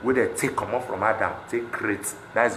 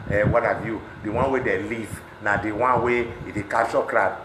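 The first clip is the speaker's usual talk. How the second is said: normal talking voice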